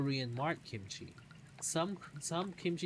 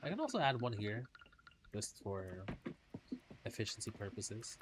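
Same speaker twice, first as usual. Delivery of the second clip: ordinary talk, nothing marked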